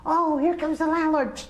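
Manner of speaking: put-on high, loud, alarmed child's cry; a frightened little kid spotting the landlord; scared kid. Scared kid warning the others as the landlord comes, loud and high.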